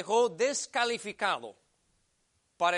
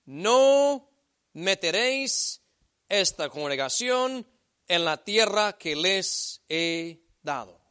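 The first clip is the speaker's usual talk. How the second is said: emphatic scripture reading; 6 dB louder than usual. Acted. Reads God's verdict aloud.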